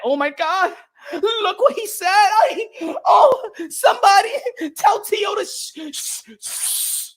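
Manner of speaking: high, whiny fake sobbing that tails off into a hush; mock crying beta male. Mocking online fans who whine, in hammed-up crying. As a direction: ham up a crybaby fan in hysterics.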